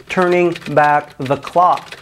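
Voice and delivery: "turning back the clock" drawn out slowly; slow mocking emphasis. Mocking the CEO's own words, slowed and stressed.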